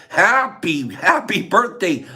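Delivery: ordinary talk, nothing marked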